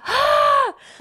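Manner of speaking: put-on high, loud child's cry of fear through laughter; panicked scream. Four-year-old panicking in the dentist's chair, laughing scream. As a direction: scream like a kid losing it in the chair.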